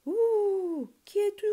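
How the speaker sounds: high, loud hooting yell; angry owl howl. A narrator's reading. Owl disturbed in its hole, angry howl. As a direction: hoot it out high, furious and loud. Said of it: "hurla le hibou très fâché".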